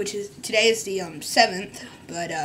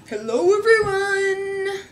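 a hint of a mopey YouTuber, drawn out; mock sad YouTuber intro; 8 semitones higher, 4 dB louder, slower than usual. Vlogger mocking a sad YouTuber's hello, lightly drawn out. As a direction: drag out the greeting. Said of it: "so deep and sad".

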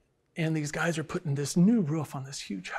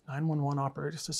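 ordinary delivery

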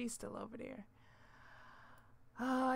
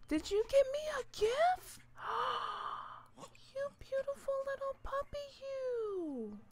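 much higher, delighted squeal over a present; high gushing delight; 12 semitones higher, 5 dB louder than usual. Real, not acted. Gamer thrilled by the puppy's gift, high gushing delight.

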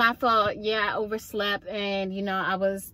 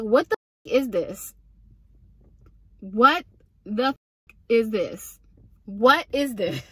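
exasperated outburst. Real, not acted. Exasperated at the botched nails.

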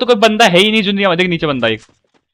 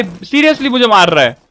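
normal talking voice

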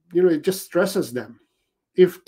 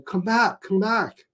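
mock pleading dog; 7 semitones higher than usual. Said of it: "they're saying".

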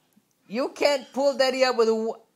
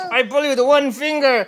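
mock straining voice; 4 semitones higher, 8 dB louder than usual. Playful strain, crying sound.

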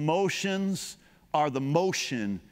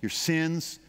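much softer, low reassuring voice; tender comfort; 5 semitones lower, 3 dB quieter than usual. Real, not acted. Pastor comforting the congregation, soft and tender. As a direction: drop down and soothe them gently.

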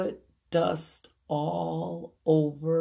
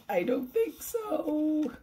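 1 playful denial; 11 semitones higher than usual. Real, not acted. Teacher answering her own question.

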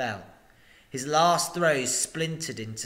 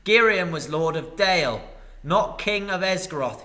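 master retorting; 5 semitones higher, 6 dB louder than usual. Acted. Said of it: "he said".

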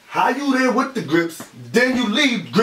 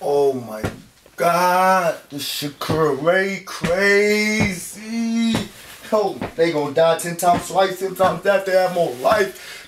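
slow baffled mutter; 7 semitones lower, much slower than usual. Acted. Alone, baffled after the fight.

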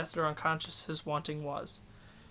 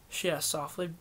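usual speaking voice

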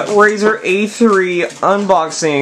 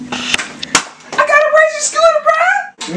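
high hyped reveal; excited; much slower than usual. Real, not acted. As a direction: shout it out, thrilled.

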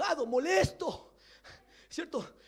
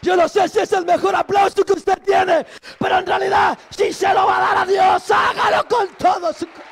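loud, ringing sermon voice, higher and louder; preaching at full force; 6 semitones higher, 14 dB louder than usual. Acted. Preacher urging the congregation to praise God fully, shouting. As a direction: preach it out to the whole church.

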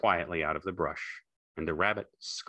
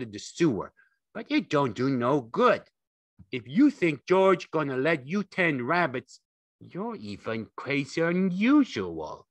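higher-pitched jeering harangue; scornful rabbit; 8 semitones higher, 4 dB louder than usual. A narrator's reading. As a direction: tear him down with contempt. Rabbit taunts Lenny.

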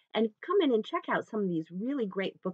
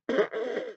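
short sputtering elephant noise; elephant trumpet imitation; 4 semitones higher than usual. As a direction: mimic an elephant's trunk with a brief buzzy burst. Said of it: "wave your trunk".

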